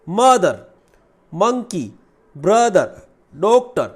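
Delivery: "मदर", "monkey", "brother", "doctor" loud, each in two distinct beats; two-syllable demonstration. Shown as a demonstration. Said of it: "ये सारे क्या होते हैं".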